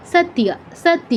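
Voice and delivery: "সত্য" as sat-tiya, t and y run together; quick joined y. Shown as a demonstration. Teacher warning against separating sounds, "সত্য" as quick sat-tiya. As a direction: join tiya swiftly in "সত্য". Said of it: "খুব দ্রুত উচ্চারণ করতে হবে".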